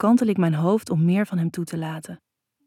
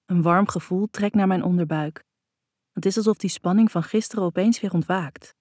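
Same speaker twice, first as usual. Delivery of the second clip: usual speaking voice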